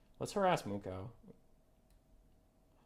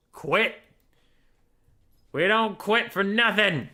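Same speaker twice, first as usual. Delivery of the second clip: loud, high battle cry of refusal; rallying shout; 6 semitones higher, 11 dB louder than usual. Real, not acted. Streamer rallying himself after six hours, loud shout. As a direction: shout it like a team chant. Rallying self to keep playing.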